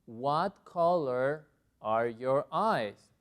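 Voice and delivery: slow, deliberate English question; slow model question. Shown as a demonstration. Teacher repeating question after a correction, slow and deliberate. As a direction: model it clearly for learners.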